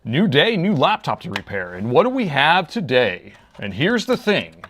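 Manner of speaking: loud, high, punchy shorts announcer read; fake announcer voice. Shown as a demonstration. Demoing his shorts voiceover for viewers, hyped announcer voice. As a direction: sell it like a fast-paced short's voiceover. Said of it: "I read it like this".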